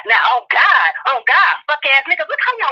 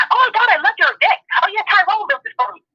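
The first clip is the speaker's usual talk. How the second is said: gushing Becky impression; 7 semitones higher than usual. Mocking Becky gushing to her friends about the deck, higher and gushing. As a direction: coo it like a sorority girl showing off her new deck.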